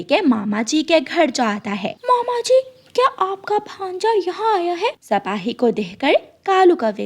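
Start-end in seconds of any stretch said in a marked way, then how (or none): 2.0-4.9 a small son warning his mother gently; little chick voice. Acted.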